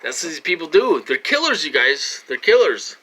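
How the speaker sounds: loud, high-pitched accusation; outraged outcry. Real, not acted. Warning viewers about these people, outraged outcry. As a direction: cry it out as an urgent warning.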